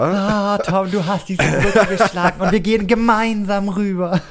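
slow, high, solemn speech; grand, laughing toast; mock solemn.

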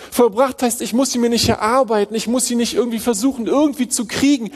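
rising, urgent sermon voice; fervent preaching. Acted. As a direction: press the point home with rising fervour. Preaching rises again.

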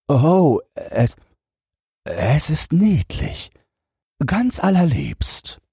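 high, slow, stammering feigned praise; an old man faking delight he doesn't feel; flustered old minister. Acted.

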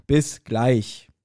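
ordinary talk, nothing marked

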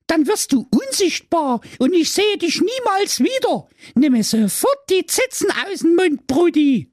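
put-on very high girlish scolding; pleading sister. Sister begging Brudi to stop, very high girlish voice. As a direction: scold him in a squeaky little voice.

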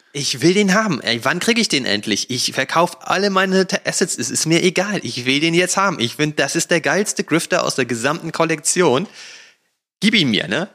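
high, pleading mock greed; eager craving. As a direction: beg for it like a kid who must have the toy. Gespielte Gier nach dem Grifter. (gespielt gierig und quengelnd, hoch)

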